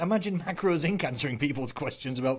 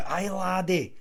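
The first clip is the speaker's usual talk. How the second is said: put-on Scottish accent; 4 semitones higher, much slower than usual. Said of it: "you can do the accent so well".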